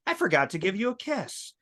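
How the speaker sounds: lightly voiced eager child; voz de criança. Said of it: "he ran back into the room and he goes".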